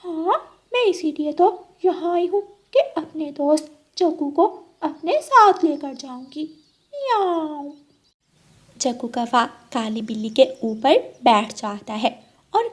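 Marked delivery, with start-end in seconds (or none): 0.0-7.7 lightly voiced cat ending on a meow; scheming cat. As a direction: coax your friend as a sly cat. Cat persuades the crow.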